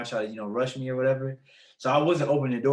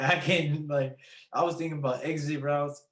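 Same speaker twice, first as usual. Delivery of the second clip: normal talking voice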